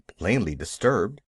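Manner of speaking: plain reading voice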